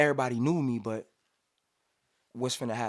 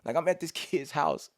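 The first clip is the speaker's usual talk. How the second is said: normal talking voice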